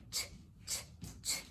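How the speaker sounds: short voiceless "ch" bursts; isolated letter sound. Shown as a demonstration. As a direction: puff out "ch" with no vowel, softly. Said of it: "los sonidos".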